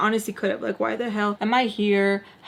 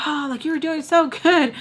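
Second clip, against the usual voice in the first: high, cooing disappointment; mock "aww" voice, pitched up; sympathetic onlookers; 7 semitones higher than usual. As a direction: imitate adults consoling a player. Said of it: "they're just like".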